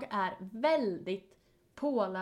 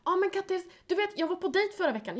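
high mimicking friend; 10 semitones higher than usual. Friend voiced in retold chat.

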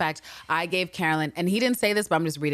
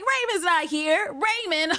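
much higher, slow sing-song mockery; mocking Villanelle; 11 semitones higher, much slower than usual. Villanelle teasing Constantine about Raymond. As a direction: mimic her playful scoffing. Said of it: "And she's like".

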